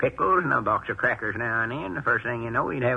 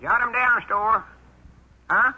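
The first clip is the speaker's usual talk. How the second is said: disguised high voice; 8 semitones higher than usual. Pretend. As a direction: pitch it way up so Lum won't know you. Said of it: "I'll use my high voice".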